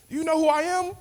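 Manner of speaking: high, indignant host voice; mock yelling host. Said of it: "he just yelling at all his guests".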